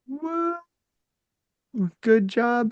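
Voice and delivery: normal talking voice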